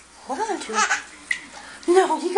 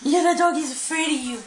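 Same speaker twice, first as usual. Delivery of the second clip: ordinary talk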